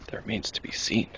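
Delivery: whispered mysteriously. Pretend. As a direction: breathe it like a secret. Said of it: "We have to be quiet".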